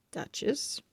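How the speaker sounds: breathy whispered name; whispered grumble. Acted. Pug mutters the cat's name, whispered. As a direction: grumble her name under your breath.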